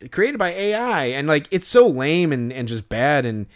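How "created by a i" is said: canned hot dog host. Acted. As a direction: present it like an automated host. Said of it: "it's all stilted".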